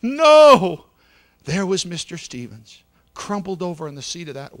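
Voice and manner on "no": high, loud wailing no; anguished scream. A narrator's reading. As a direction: scream it in grief. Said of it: "she cried".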